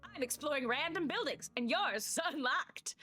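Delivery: high, emphatic cleric voice; brash cleric. Acted. Player answers as the cleric.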